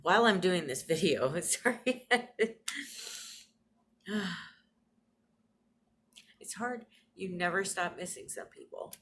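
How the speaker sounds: choked-up, holding back tears; fighting tears while filming; getting emotional. Real, not acted. Vlogger tearing up about her late grandma, real emotion. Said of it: "start getting emotional here".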